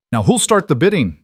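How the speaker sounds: loud high auction call; auctioneer shout. A narrator's reading. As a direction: shout it to the crowd. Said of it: "the auctioneer shouted".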